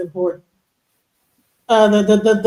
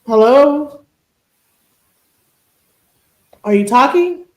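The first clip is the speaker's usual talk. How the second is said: louder, higher questioning call; 4 semitones higher, 4 dB louder, slower than usual. Real, not acted. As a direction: check if a silent guest is there. Calling to a silent panel guest.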